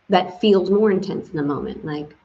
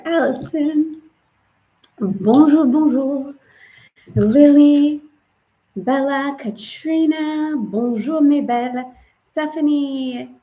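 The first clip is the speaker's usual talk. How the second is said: excited greetings; 7 semitones higher, 4 dB louder, slower than usual. Real, not acted. Greeting viewers by name warmly.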